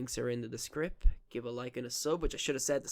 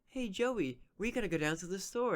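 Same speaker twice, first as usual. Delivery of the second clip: higher-pitched cartoon dub voice; Yugi; 7 semitones higher than usual. Acting out an anime dub for the co-host, high character voice. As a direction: imitate an anime hero calling a friend. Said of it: "so it's like Yugi".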